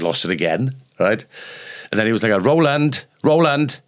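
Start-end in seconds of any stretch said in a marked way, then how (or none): 2.4-3.8 high, loud tannoy call of the name Roland; a fed-up purser calling over the speakers; exasperated announcement. Retold or imitated. Ship tannoy calls his name.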